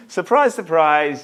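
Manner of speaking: mock surprise, first word jumping high; dry sarcastic "surprise, surprise". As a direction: ironic shrug in the voice. Sarcastic aside about no job.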